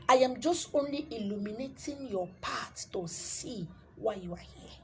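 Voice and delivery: ordinary talk, nothing marked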